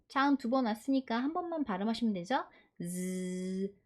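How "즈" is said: 즈 as a long tongue-tickling z; buzzing z sound. Shown as a demonstration. Teacher showing learners the z in drizzle, 즈 as buzzing z. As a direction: hold 즈 as a buzzing English z. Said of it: "혓바닥 간질간질".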